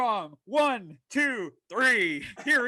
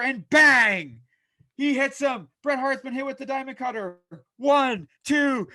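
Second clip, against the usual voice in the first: louder burst on the finisher and count; excited call; 5 semitones higher, 9 dB louder than usual. Acted. Announcer calling the Diamond Cutter and count, excited and loud. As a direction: sell the big move to the crowd.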